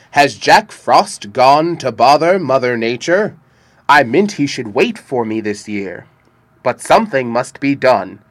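loud commanding king; a winter king calling his court; booming king voice. A narrator's reading.

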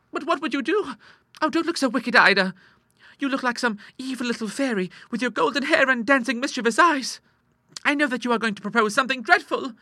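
put-on very high, worried girlish voice; anxious sister Clara. Sister fearing they went too far, high worried voice. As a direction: fret at her in high pitch.

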